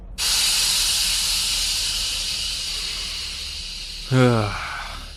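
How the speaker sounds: long exhale, then a sighed "ah"; contented sigh. Acted. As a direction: breathe out slowly, savouring the air. Taking in the home planet.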